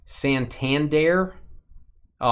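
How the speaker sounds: ordinary talk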